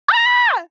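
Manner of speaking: hammed-up shriek of pain; mock pained yelp. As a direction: yelp like you just got punched. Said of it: "and he went".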